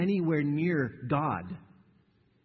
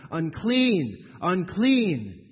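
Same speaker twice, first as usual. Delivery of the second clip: loud, higher, drawn-out cry of "Unclean, unclean"; crying aloud; 4 semitones higher, 6 dB louder, much slower than usual. Shown as a demonstration. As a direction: call it out like a leper warning others. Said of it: "cry aloud".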